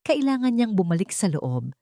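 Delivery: ordinary delivery, nothing marked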